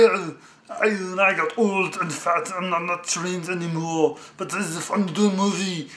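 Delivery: put-on boastful movie-star voice in a heavy Austrian accent; Arnold Schwarzenegger impression. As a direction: keep rambling in a hammed-up Austrian accent. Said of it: "he's probably going to do that in that accent".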